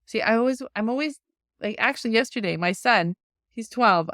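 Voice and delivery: usual speaking voice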